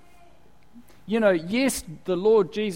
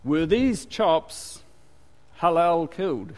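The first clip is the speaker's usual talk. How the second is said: slow fussy question; slower than usual. Retold or imitated.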